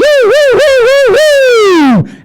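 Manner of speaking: very high loud siren whoops; shrieking siren imitation. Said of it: "flashing lights, siren".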